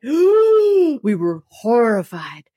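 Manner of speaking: gasping, slow and shaken; horrified gasp. Retold or imitated. Parent hearing the doctor say cancer, horrified gasp. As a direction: catch your breath in horror. Reacting to blunt cancer news.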